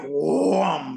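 revving engine noise swooping past; vocal sound effect of a speeding car; imitated car roar. Imitates Camaro ripping round the corner.

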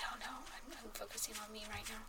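whispered, focused under the breath; whispering. Real, not acted. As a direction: whisper it while concentrating hard. Concentrating on the game.

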